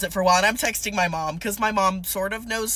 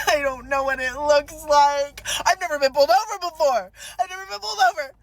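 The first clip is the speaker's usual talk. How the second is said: high hammed-up wail, climbing higher; mock sobbing; 6 semitones higher, 4 dB louder than usual. Sobbing driver hands cop her papers, mock wailing. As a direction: blubber helplessly in pretend tears. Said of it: "I'm, like, sobbing and heaving".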